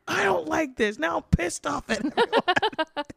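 comic angry outburst; mock angry shout. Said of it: "And I'm like".

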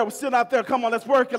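usual speaking voice

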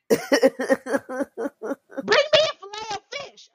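very high, slow mock mom voice, laughing through it; the mom calling her order, squeaky high and drawn out.

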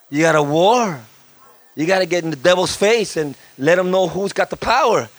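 normal talking voice

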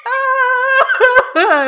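high, loud laughing scream; startled shriek. Retold or imitated. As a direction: shriek like a scared kid. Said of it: "I was like".

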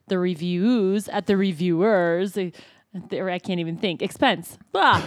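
ordinary talk

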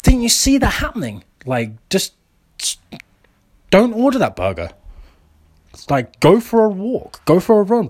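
high, preachy mock gym-bro voice; mocking what fit people tell the unfit, high and preachy; imitating fit people. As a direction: sneer at the unfit like a smug athlete. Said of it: "look at people who are out of shape and going".

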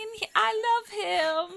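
high, squeaky newborn babble; baby creature voice. Pretend. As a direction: coo it like a newborn creature just hatched. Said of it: "little baby Winkle who's never opened their eyes".

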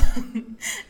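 quick bright laugh; a brief amused laugh; short laugh. Real, not acted. Laughs at her own price plug.

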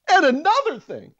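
loud, high-pitched fake outburst; mock rant. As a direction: pretend to launch into another tirade, shouting. Mock rant, then just kidding.

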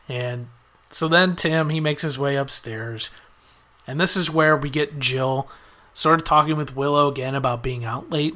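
ordinary talk

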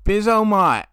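imitating the Australian accent; mock Aussie. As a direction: throw it back like an Aussie. Mimics Aussie slang.

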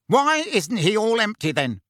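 high-pitched, indignant bluster. A narrator's reading. Uncle disputes Harry's account of the attack, blustering. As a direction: keep challenging, voice pushed up high. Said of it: "blustered Uncle Vernon".

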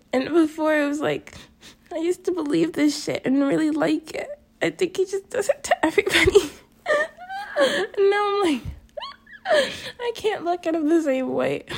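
high-pitched tearful voice; real crying. Real, not acted. Hurt vlogger saying the friend seems fake, crying.

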